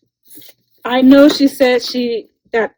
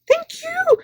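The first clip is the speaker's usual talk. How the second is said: much higher, squeaky little thank you; squealed thank you; 3 dB quieter than usual. Real, not acted. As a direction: squeal it in delight.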